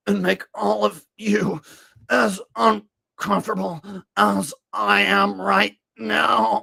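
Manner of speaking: high, strained, raspy RFK Jr. voice; RFK impression. Comedian mocking RFK at a hearing, strained reedy voice. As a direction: strain through the words like RFK. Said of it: "New impression added to my repertoire".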